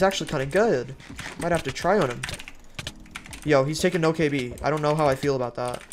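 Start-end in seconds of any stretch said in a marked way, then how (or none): none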